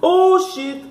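high, shocked swearing; startled cry. Real, not acted. Player reacting to the game's scare, high startled cry. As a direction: cry out high at a sudden fright.

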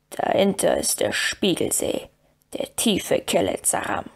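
a dwarf missing the lake; mildly sad dwarf. Acted. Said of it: "sagte Gimli traurig".

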